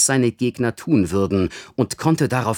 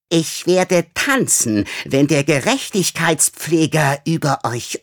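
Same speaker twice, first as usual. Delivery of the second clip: high, slow gloating hiss; gloating villain; 4 semitones higher, slower than usual. Acted. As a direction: savour the threat slowly. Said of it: "Aufgeregt trippelte er".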